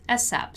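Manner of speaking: "asap" repeated as one spoken word; acronym as a word. Shown as a demonstration. Teacher repeats the other accepted reading, acronym as a word. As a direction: say "asap" as a word, not as letters. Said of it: "may be read A S A P".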